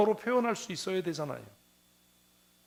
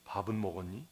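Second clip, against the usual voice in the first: elder asking; 8 semitones lower, 10 dB quieter than usual. Retold or imitated. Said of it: "어떻게 했는지 아세요".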